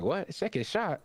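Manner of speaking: high, questioning retold reaction with a rising end; incredulous echo. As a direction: echo it back in mock disbelief, pitched well up. Acted quote, opponent questions the announcement.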